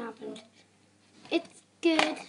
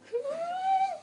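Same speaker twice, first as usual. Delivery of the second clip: high-pitched squealing laugh; giddy squeal; 14 semitones higher than usual. Real, not acted. Vlogger delighted with the tail, giddy squeal.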